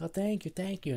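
high, awkward doubled thanks; awkward repeated thanks. Retold or imitated. Speaker with nothing else to say, high awkward thanks. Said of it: "I'm just brain dead".

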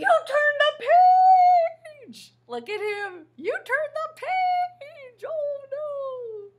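high, loud dismayed cry; outraged monster wailing at the kids; Grover wail. Acted. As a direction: shriek it at the reader. Grover's outburst at page turn.

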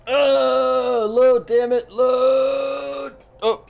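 high, strained pleading; high-pitched frustrated whine. Real, not acted. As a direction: beg the game to load in a squeaky voice. Game won't load, frustrated.

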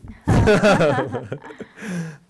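a big burst of real laughter; loud laugh. Real, not acted. Easterner laughing at playing a Thracian, loud real laugh.

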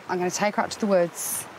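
usual speaking voice

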